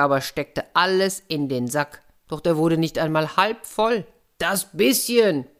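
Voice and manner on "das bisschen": calling out. A narrator's reading. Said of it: "rief er".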